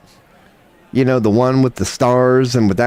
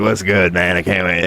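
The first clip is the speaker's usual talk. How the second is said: low strained mock RFK; lightly done RFK voice; RFK impression; 4 semitones lower than usual. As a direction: croak it like RFK. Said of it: "He's like".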